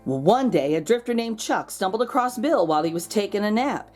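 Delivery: ordinary delivery, nothing marked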